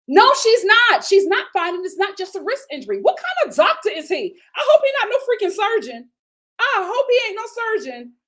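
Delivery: high, loud exasperated rant; outraged. Real, not acted. Vlogger defending the injured nanny, loud exasperated rant.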